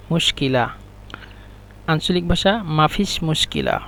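ordinary talk, nothing marked

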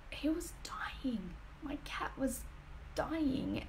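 slow, hushed whisper. Real, not acted. Owner telling viewers her cat was dying, whispered. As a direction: breathe the bad news without voice.

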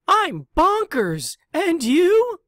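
a nutty classmate dog answering Pig, high and loud; bonkers dog. Acted. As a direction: play a wacky mutt. Crazy classmate dog voiced.

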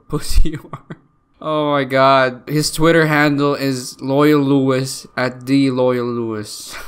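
ordinary talk, nothing marked

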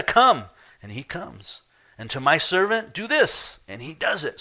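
loud, high, forceful orders; preacher voicing a centurion's commands; commanding centurion. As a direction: speak like an officer giving commands.

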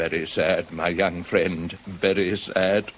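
hushed, deep, mournful head-shaking; grave lament. Acted. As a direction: lament it quietly and heavily. Badger laments Toad's ruin.

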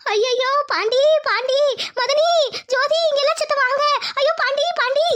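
calling for help after the fall, in a put-on panic; staged wailing cry. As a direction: wail out to wake the house, putting it on. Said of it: "நான் சத்தம் போட ஆரம்பிக்கிறேன்".

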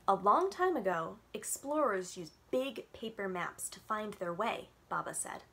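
gentle storytime read-aloud with little voices; reading to children. A narrator's reading. Librarian reading a picture book to kids on Zoom, storytime voice.

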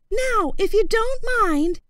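put-on high, courteous young voice; boy's high voice. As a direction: politely turn the crocodile down, voiced high.

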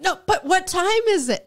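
high-pitched pressing question; mock insistence. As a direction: demand an answer as an exasperated character. Acting characters pressing the skull.

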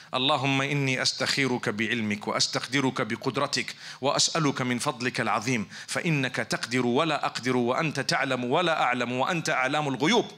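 rapid, low recitation of Arabic; very fast. Shown as a demonstration. Preacher running through the istikhara dua, very fast. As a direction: rattle off the supplication at speed. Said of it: "I said it very fast".

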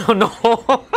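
high, loud oh no through laughter; laughing dismay. Real, not acted. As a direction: crack up while saying it. Laughing at fail clip.

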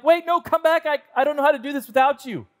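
high, fast pleading after the leaving waitress; panicked plea. Retold or imitated. Nervous date calls the waitress back, high and hurried. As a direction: call her back in a rush of panic. Begging the waitress not to leave.

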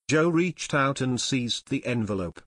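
ordinary delivery, nothing marked

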